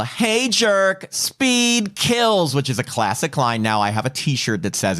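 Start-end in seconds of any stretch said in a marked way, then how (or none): 0.0-2.5 higher-pitched retold yell without much volume; mock yell. Recapping Annie heckling a passing driver, mock yell. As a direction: call it out high, not truly shouting. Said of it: "Annie decides to yell".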